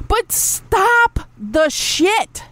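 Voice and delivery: slow, stretched-out demand; drawn-out scolding. Real, not acted. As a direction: drag every word out to scold them. Scolding viewers.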